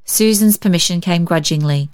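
ordinary delivery, nothing marked

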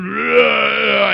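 put-on loud whiny toddler groan; imitated child whine. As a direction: wail it like a cranky toddler. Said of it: "All I heard was".

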